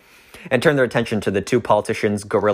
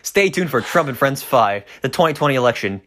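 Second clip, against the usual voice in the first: trailer announcer; 5 semitones higher than usual. Acted.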